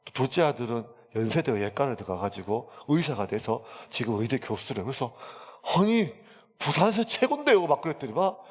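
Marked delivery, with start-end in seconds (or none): 5.8-7.6 much higher, louder amazed exclamation. Retold or imitated. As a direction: exclaim in disbelief, pitched high. Amazed reply to the old man. (높고 크게 놀라며)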